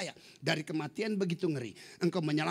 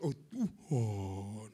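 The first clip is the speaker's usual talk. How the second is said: very slow, low, drawn-out address to God; put-on prayer voice; 8 semitones lower, 3 dB quieter, much slower than usual. Mocking how people address God, exaggerated prayer voice. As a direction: stretch it out with mock piety. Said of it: "bahasa hari-harinya beda".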